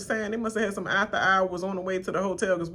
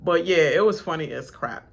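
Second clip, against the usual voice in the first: ordinary delivery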